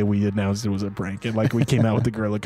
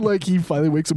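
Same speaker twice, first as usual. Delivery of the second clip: ordinary talk, nothing marked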